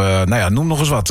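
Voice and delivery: ordinary talk, nothing marked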